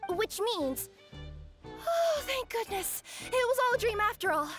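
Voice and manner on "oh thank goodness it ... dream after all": high gush of relief; relieved. Acted. Kirara thinking it was a dream, relieved. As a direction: let the worry spill out happily.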